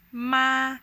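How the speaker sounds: "ma" held high and flat; first tone. Shown as a demonstration. Teacher demonstrating the first intonation, "ma" high and flat. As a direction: keep "ma" on one high, level pitch. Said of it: "a very high and flat pronunciation".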